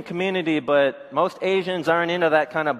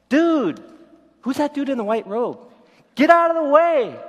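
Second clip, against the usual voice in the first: much higher, louder brush-off; rough logger retort; 8 semitones higher, 4 dB louder than usual. Retold or imitated. Loggers yelling at the man in the robe, rough brush-off. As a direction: shove the stranger aside. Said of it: "We've going".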